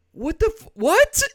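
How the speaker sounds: high, loud incredulous yelling; exasperated. Real, not acted. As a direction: shriek in disbelief. Exasperated outburst at question.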